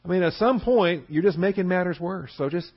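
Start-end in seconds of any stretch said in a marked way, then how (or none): none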